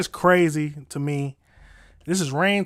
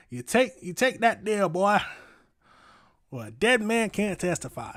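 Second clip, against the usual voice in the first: higher mock Southern accent; 6 semitones higher than usual. Streamer imitating the judge's threat to a defendant, Southern accent.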